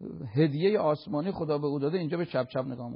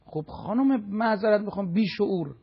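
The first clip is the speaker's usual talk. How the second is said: raised, indignant scolding; harsh rebuke; 5 semitones higher, 5 dB louder than usual. Real, not acted. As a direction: scold them bluntly in a higher voice. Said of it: "مجبورم گاهی با یه ادبیات تندی حرف بزنم".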